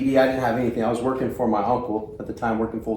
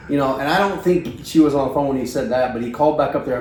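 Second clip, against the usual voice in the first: ordinary delivery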